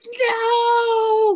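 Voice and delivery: very high, loud drawn-out wail; mock anguished shout. Quoting a favourite movie line, high hammed-up shout. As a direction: shriek it in hammed-up outrage. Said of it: "where it's just like".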